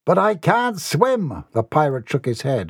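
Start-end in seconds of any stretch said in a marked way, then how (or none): 0.0-1.5 high, drawn-out plea; pleading. Acted. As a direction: plead with the captain, stretching the words. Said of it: "his eyes pleading".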